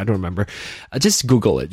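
ordinary talk, nothing marked

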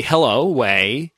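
slow "hello, wei." as on answering the phone; drawn-out rising "wei" for a phone hello; phone greeting "wei". Shown as a demonstration. Host showing how Chinese answer the phone, slowly. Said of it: "would be".

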